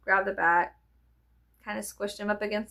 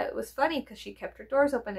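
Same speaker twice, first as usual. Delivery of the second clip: ordinary delivery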